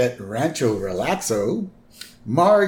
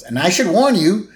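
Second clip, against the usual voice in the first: high, loud, slowing warning; mock-ominous aunt, drawing out the last words; put-on cartoon warning voice; Patty; 6 semitones higher, 4 dB louder than usual. Acting out Patty warning Bart.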